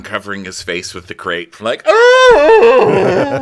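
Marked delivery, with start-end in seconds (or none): 1.9-3.3 very high, loud long howl; exaggerated yowl of agony; mock pain wail. Kyle lying down covering his face, high loud mock wail.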